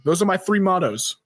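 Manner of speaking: ordinary delivery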